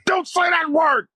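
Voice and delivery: high, strained demon screech; demonic voice. Retold or imitated. Possessed friend objects to hearing Jesus named, demonic screech. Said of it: "This demonic voice arose out of him".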